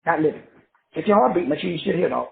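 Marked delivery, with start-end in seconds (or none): none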